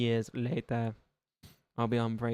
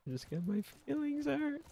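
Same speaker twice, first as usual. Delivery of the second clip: much higher, softer, whiny little voice; mock hurt whine; 5 dB quieter than usual. Streamer jokes about being hurt by viewers, high mock whine. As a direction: whimper it like a wounded child, hamming it up. Joking self-pity on stream.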